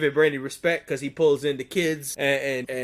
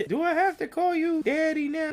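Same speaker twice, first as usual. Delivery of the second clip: put-on high, soft child's question; imitated little girl; 12 semitones higher, 4 dB quieter than usual. Little girl asking uncle what to call him, imitated high voice. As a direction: pipe it up as a small, unsure kid. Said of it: "she was like".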